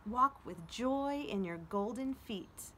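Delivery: slow, uplifting poem recital; poem reading. Acted. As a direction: read it as a gift of encouragement. Reads poem Majestic aloud.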